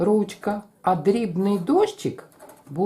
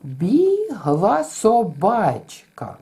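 бігла собачка very slow, БІГ held; бігла собачка drawn out, long first and last; rhythm demo; 3 semitones higher, much slower than usual. Shown as a demonstration. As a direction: hold БІГ, hurry the middle. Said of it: "Наприклад".

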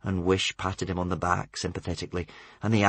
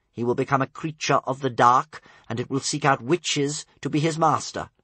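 rising, louder grim warning; 6 semitones higher, 5 dB louder than usual. A narrator's reading. Caliburn warning Tsar of the dark side, grim and building. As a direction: build the doom toward the end.

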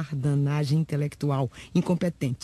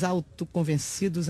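ordinary delivery

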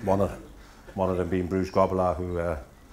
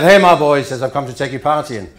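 Bruce voice; 6 semitones higher, 12 dB louder than usual. Retold or imitated. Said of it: "he said".

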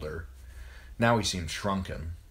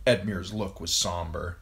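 ordinary delivery, nothing marked

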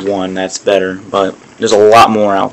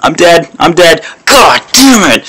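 frustrated; 7 semitones higher than usual. Real, not acted. Frustrated at dying.

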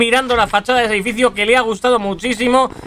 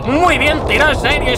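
normal talking voice

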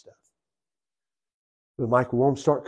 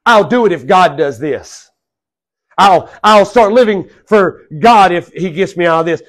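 1 mock sign seeker's vow; 6 semitones higher, 10 dB louder than usual. Preacher acts out a sign seeker.

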